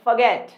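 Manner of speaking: forget as fuh-GET, silent r; correct pronunciation. Shown as a demonstration. Teacher telling students how we say it, correct pronunciation. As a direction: drop the r in forget, schwa first. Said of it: "इसे हम कहते हैं forget".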